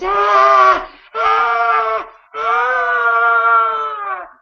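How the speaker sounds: high loud agonised screams; dying screams. Acted. People trapped in the burning stables, high agonised screams. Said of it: "then in screams".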